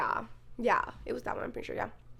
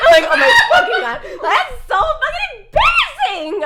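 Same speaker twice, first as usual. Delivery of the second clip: high, loud, laughing exclaim; laughing outburst; 12 dB louder than usual. Real, not acted. Retelling a classmate asking if she's okay, laughing loudly. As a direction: burst out in embarrassed laughter.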